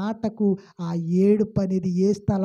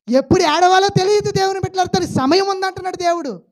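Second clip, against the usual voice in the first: loud, high preaching voice, pushing each phrase out; full-force preaching; 10 semitones higher, 8 dB louder than usual. Acted. Preacher booming at the congregation in full preaching force. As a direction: preach it hard to the pews.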